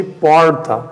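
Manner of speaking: "porta" with a rolled-back American R in the middle; Portuguese "porta" with a heavy American accent. Retold or imitated. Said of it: "Vem um americano e fala pra ti".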